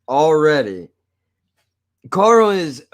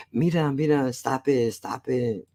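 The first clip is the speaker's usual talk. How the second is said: camp Spanish guy; 5 dB quieter than usual. Retold or imitated.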